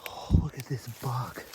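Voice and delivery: ordinary delivery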